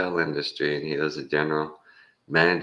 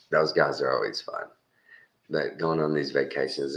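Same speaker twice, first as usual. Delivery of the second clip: usual speaking voice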